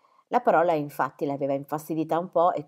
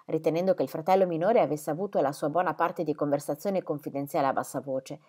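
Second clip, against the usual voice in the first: ordinary delivery, nothing marked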